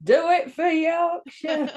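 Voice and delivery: rally the troops for Yorkshire, hammed up; mock shout of Yorkshire pride; mock rallying cry.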